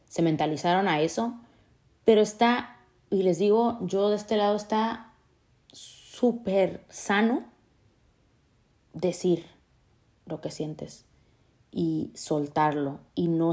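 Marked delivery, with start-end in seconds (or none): 5.7-7.4 "súper sano" stretched out slowly; emphasis on súper sano. Real, not acted. Telling viewers that letting feelings out is "súper sano", slow and stretched. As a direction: lean on "súper sano", drawing each word out. Stressing the healthy choice.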